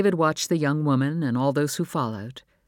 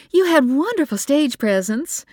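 much higher, louder, bubbly mother's praise; gushing mother; 13 semitones higher, 7 dB louder than usual. Acted. Mother praising her son's stage presence, gushing. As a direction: gush over him like a proud mom. Mother gushes over son.

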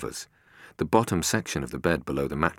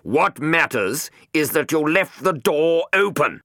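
much higher, loud, slow furious yell; shouting in fury; 13 semitones higher, 8 dB louder, slower than usual. A narrator's reading. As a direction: blast him for the mistake. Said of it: "could hear him shouting".

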